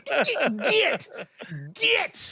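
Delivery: very high, sharp squeal; mock shooing. Reader banishing a whiny protagonist, high-pitched mock shooing. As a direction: squeak the word high, one burst at a time.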